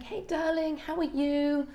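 high, bright-pitched sing-song hello; chirpy midwife greeting. Retold or imitated. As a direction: greet her like a cheery midwife. Midwife Annie greets labouring mum.

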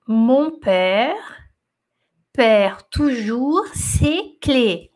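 "Mon père perd toujours ses clés" drawn out slowly, word by word. Shown as a demonstration. Teacher reading the sentence to students, very slowly. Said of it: "Vou falar devagarzinho".

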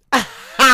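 shrieking burst of laughter; loud high laugh. Real, not acted. Cracking up at the mama girl bit, loud high laugh. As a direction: let out a big high cackle.

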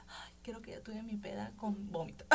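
laughing whisper; whispered laughing aside. Retold or imitated. Recalling her first drunk night, she whispers it with a laugh. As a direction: giggle it under your breath.

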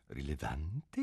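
rilevante murmured low and soft; king muttering rilevante to himself; under his breath. A narrator's reading. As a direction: try the word rilevante quietly to himself. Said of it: "continuò a bassa voce".

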